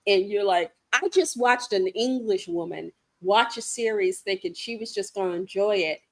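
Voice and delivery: usual speaking voice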